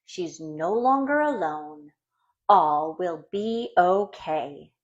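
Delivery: usual speaking voice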